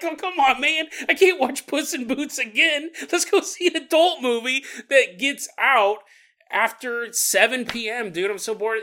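whiny pleading. Pretend. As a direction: beg like a bored buddy. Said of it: "You're like".

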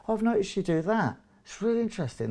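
high, light, softened observation; gentle reminder. Shown as a demonstration. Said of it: "what we call a gentle reminder".

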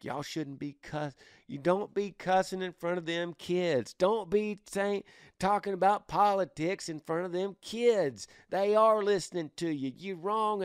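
wag a finger like a nagging grandmother; a grandma scolding her son about cussing; judgmental grandma. Retold or imitated. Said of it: "judgmental grandma".

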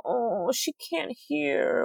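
slow, soft pitying voice; imitating a pitying introduction; mock pity. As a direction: drag it out, hushed and sorry for her. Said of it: "does this pity thing".

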